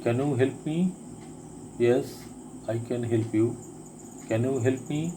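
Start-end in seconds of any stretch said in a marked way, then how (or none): none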